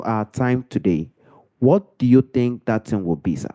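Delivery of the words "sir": ordinary talk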